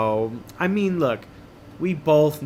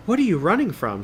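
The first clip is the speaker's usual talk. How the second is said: higher, lightly voiced young girl; girl's question; 6 semitones higher, 3 dB louder than usual. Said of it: "she asks".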